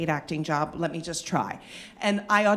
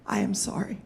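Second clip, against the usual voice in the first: hushed apology; 5 dB quieter than usual. Retold or imitated. Boss apologizing late at night.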